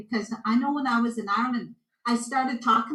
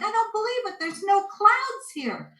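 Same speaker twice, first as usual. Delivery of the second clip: amazed driver; 10 semitones higher, 5 dB louder than usual. Retold or imitated. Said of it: "he's like".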